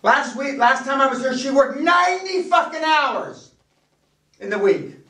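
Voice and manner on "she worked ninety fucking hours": ninety fucking hours, higher and slow, each word leaned on; outraged emphasis. Real, not acted. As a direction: hammer home the ninety hours. Boss stressing assistant's long week.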